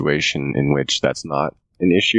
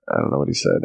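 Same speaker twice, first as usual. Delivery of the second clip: usual speaking voice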